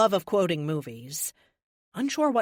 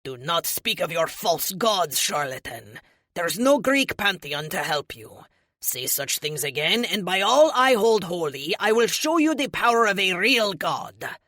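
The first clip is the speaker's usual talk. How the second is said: fierce warning; 2 semitones higher, 7 dB louder than usual. A narrator's reading. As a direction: swell into a booming divine threat. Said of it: "Moonwatch breathed in fiercely".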